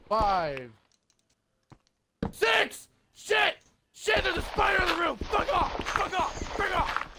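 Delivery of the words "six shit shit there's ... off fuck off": high panicked shouts; screaming at a spider. Real, not acted. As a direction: yell at the spider to get away. Spider in the room.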